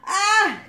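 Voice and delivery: loud, high exasperated "ah"; sudden yell of dismay; exasperated cry. Acted. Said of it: "cried Bentley, exasperated".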